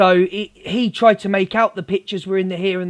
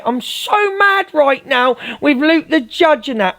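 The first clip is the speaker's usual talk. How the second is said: much higher, whiny put-on rant with drawn-out words; mock outrage; 8 semitones higher than usual. Streamer parodies rivals mad at Luke the Judge, mock outrage. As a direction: parody someone fuming, pitched way up and stretched out.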